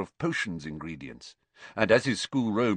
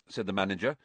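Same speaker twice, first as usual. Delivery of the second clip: plain reading voice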